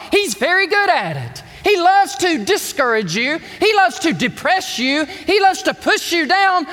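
ordinary talk, nothing marked

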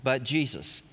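ordinary talk, nothing marked

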